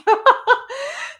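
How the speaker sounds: high bright giggle; high real laugh. Real, not acted. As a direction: laugh at your own joke. Laughing at her own parody.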